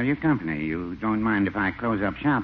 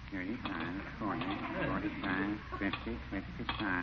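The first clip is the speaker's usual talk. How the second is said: soft murmured tally of coins; counting under breath; 10 dB quieter than usual. Acted. Shopkeeper counting his cash softly, half ignoring his friend. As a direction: count the till quietly to yourself.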